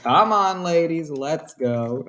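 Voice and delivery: lightly voiced slow king beckoning; mock royal summons; a hint of smug king. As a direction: coax them along.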